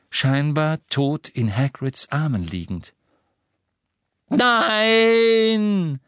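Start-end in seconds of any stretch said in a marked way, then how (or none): 4.3-6.0 high loud cry of despair; anguished scream. Acted. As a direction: scream out in horror. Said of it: "Der Schrei war umso schrecklicher".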